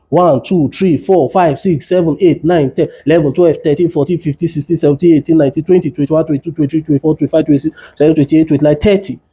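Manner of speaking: steady rhythmic counting in pairs; even metronome count of thirty; compression count. Shown as a demonstration. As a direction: count out each chest push to a beat. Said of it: "you give thirty chest compression".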